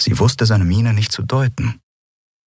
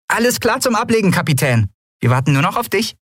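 a playful high mock military report; mock salute report; 14 semitones higher, faster than usual. A narrator's reading. Nelen parodying a salute to the captain, mock military. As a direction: report for duty like a cheeky little sailor. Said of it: "parodierte einen Salut".

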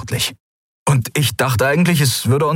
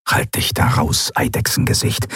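hissed aside; 8 semitones lower than usual. A narrator's reading. Telling lizard to butt out.

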